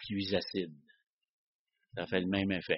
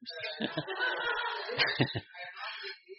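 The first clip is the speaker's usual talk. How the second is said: a real burst of high laughter, quieter; 8 dB quieter than usual. Real, not acted. Pastor laughing at Marc's answer, high real laugh. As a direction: laugh out high and light at the listener's remark.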